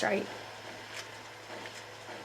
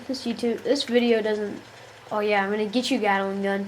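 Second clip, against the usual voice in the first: ordinary delivery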